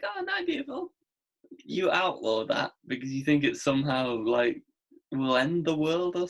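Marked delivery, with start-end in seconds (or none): 0.0-0.9 very high sweet mock voice; mock lovey-dovey. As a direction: coo it in a silly high voice. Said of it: "I can't be like".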